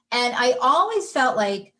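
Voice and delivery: ordinary delivery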